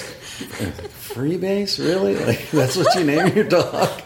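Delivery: high, incredulous question through laughter; laughing disbelief. Real, not acted. Storyteller reacting to absurd dog name, laughing disbelief. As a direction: ask it amused and appalled, cracking up.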